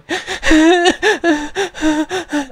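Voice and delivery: high, drawn-out mock sobs; fake sobbing. Commentator shows sobbing noises without tears, hammed-up fake sobbing. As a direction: sob noisily with obviously dry eyes. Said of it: "making sobbing noises without actual tears".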